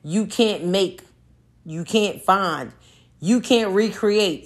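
each can't stressed and drawn out; slow emphatic list. Real, not acted. To the audience, slowly stressing each can't. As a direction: spell it out slowly, one phrase at a time. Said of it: "The shit that we have".

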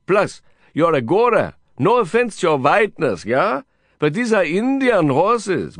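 put-on high Indian-accented coaxing; exuberant Indian cowboy. Cowboy friend offering his lucky hat, coaxing high. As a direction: coax him eagerly, pitched high. Voices Vikram in the dialogue.

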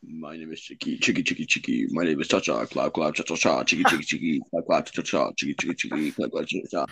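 low, soft sing-song chant of the silly name rhyme; lowest pitch voice. Pretend. Friend asked for the lowest voice, so he chants it deep. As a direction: chant it as deep as you can go. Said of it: "in your lowest pitch voice".

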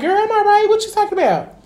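high, indignant denial; defensive friend. Retold or imitated. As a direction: brush it off high and offended. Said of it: "And you like".

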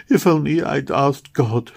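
put-on a girl's lament over the dead baby; understated sobbing plea; grieving cry. A narrator's reading. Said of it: "she cried to herself".

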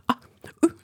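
high, flustered vocal noises. Retold or imitated. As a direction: squeak out panicked sounds.

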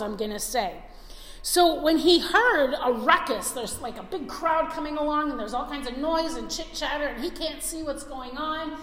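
ordinary talk

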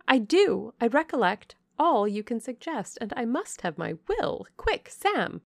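a spoilt beauty demanding her way; imperious young lady. Acted. Blanche overrules her mother and orders Sam, high and imperious.

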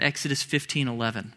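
ordinary talk, nothing marked

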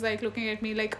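ordinary delivery

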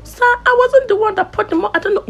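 high, timid mock student; meek student voice. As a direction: play the scared student pleading innocence. Student answering angry lecturer.

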